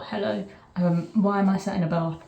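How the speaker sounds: ordinary talk, nothing marked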